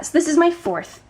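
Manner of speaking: ordinary talk, nothing marked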